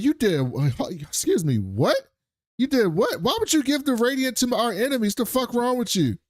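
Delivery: shriek-ish mock outrage, high throughout; high, appalled "you did what?"; outraged disbelief. Emblem Miss outraged at Seldon.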